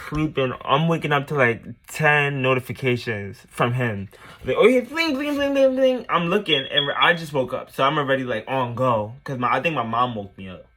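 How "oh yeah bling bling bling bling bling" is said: high sung-out bling bling like phone alerts; mimicking notification chimes. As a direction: chirp each bling way up high like a buzzing phone.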